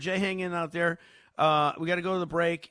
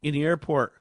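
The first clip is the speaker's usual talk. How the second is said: usual speaking voice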